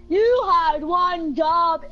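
drawn out, every word slow; slow mocking drawl. Real, not acted. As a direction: stretch it out to mock.

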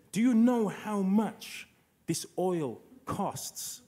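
high, slow, drawn-out mock indignation; indignant mother. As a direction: imitate an outraged mum, spacing out each word. Indignant mother voiced in a story.

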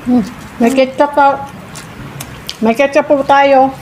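chewing while talking; muffled by food; mouth full of pizza. Real, not acted. Seen 0: Woman bit pizza at -1.0; cheeks and lips show chewing throughout.

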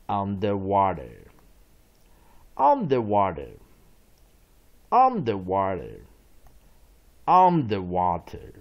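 slow, loud, emphatic word drill; read-along model. Shown as a demonstration. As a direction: say it slowly and loudly for students to copy. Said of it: "read along with me". (大声慢速领读)

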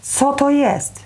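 high-pitched, mildly cried question; exclaiming cry. A narrator's reading. Mother pointing at a painted egg, mildly crying out. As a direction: cry out in alarm, without full volume. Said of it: "krzyknęła mama Żakowa".

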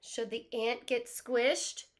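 plain reading voice

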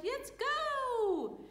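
high excited shout. Acted. Excited shepherds setting off to find the baby, high-pitched. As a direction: squeal it with eagerness. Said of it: "and they said".

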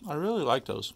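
talking through a mouthful; mouth full. Real, not acted. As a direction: say it while still chewing. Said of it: "Sorry for talking with my mouth full".

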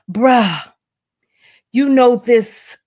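slow knowing drawl for the friend; mock street voice. Reading friend's text in character.

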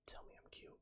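bare whisper, barely there; whispered demand. Pretend. Streamer begging chat for a compliment, whispered demand. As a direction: whisper the order playfully.

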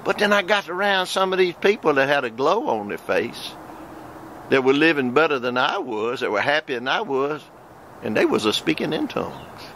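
ordinary delivery

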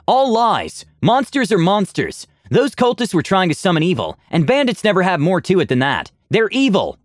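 angry shout. Acted. Aura shouting denial.

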